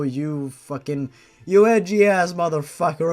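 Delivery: plain reading voice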